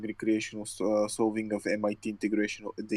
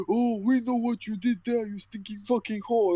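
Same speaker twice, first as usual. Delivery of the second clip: higher mock yell of the man outside; retold yelling man; 10 semitones higher, 3 dB louder than usual. Host imitating a man yelling outside his window, higher mock yell. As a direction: imitate the man screaming at her, raised. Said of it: "The man was, uh, was yelling".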